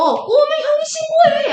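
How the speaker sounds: very high, drawn-out mocking sing-song; sarcastic taunt. Shown as a demonstration. Teacher demonstrates the younger brother goading his brother, exaggerated sarcasm. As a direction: stretch it out high to goad him. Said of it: "약간 이런 거야".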